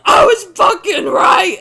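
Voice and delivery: screaming in a demonic, triumphant roar; full-force monstrous victory scream. Pretend. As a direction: yell it slowly like a demon on the loose. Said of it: "screaming in my best demon".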